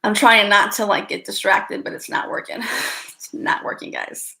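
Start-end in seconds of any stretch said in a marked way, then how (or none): 1.8-4.3 giggly whisper. Real, not acted. Laughing, distracted, whispering.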